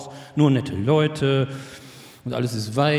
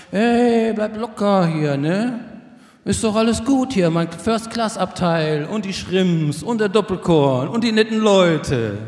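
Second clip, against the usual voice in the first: higher, drawn-out "relax, it's all good"; breezy mock reassurance; 7 semitones higher, 5 dB louder, slower than usual. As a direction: play someone waving away every worry, stretching the words. Beschwichtigende innere Stimme, gespielt. (gespielt lässig beschwichtigend)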